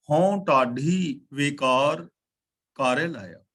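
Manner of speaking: ਹਉ ਢਾਡੀ ਵੇਕਾਰ read very slowly, word by word; slow, deliberate reading of the line; slow model recitation. Shown as a demonstration. Teacher recites the line slowly for students to copy. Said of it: "ਇਹਨੂੰ ਢ ਕਰਕੇ ਬੋਲਾਂਗੇ".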